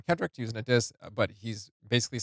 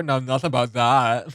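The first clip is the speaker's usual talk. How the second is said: mock Valley girl, louder; hammed-up blonde Valley girl take on the line; Valley girl imitation; 6 dB louder than usual. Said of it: "He almost sounds like a Valley girl".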